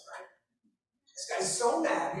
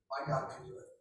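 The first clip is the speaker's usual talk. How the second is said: usual speaking voice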